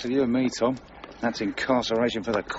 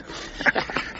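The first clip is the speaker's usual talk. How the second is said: breathy snicker. Acted. Mocking the greedy master.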